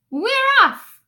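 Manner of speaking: whooping crew cheer; crew shouting. Acted. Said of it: "we all shouted".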